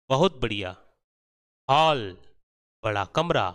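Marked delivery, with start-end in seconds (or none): 1.7-2.0 हॉल with a rounded "aw", louder; aw sound. Shown as a demonstration. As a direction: say हॉल as "hawl". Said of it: "आपने गौर किया".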